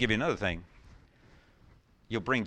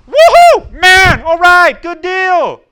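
shouted woohoo, much higher and louder; cheering angels; 20 dB louder, much slower than usual. Pretend. Preacher imagining angels celebrating a saved sinner, cheering. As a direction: cheer like a crowd at a win. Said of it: "I think the angels are saying".

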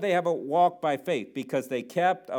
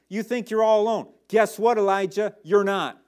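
calling out; 5 semitones higher, 6 dB louder than usual. Acted. Preacher calling out to Elijah.

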